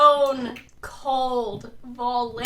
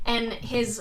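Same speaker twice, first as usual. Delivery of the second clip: ordinary delivery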